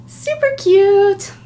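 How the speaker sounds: high, slow, loud gush; excited squeal. Real, not acted. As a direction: gush over it. Repeats louder.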